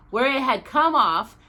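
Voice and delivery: usual speaking voice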